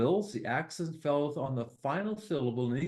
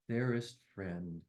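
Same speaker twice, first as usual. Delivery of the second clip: much softer, lower, slow final words; closing poem line; 9 semitones lower, 7 dB quieter than usual. Acted. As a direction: let the last line fade gently.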